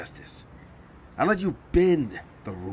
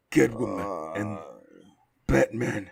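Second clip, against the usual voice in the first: ordinary talk, nothing marked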